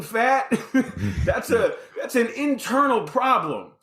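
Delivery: chuckling through the words; laughing. Real, not acted. Telling the host about his denial while laughing. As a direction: laugh it out at himself.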